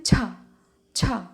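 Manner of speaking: छा with a breathy h after the vowel; छः said for kids; model pronunciation. Shown as a demonstration. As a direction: show छा with the visarga sound. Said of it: "Hindi में कैसे पढ़ते हैं?".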